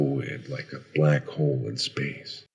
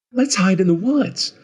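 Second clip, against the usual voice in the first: put-on high, loud kid voice; high-pitched playmate calling out; another child; 6 dB louder than usual. A narrator's reading. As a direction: propose a game like an excited kid. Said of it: "I heard another voice".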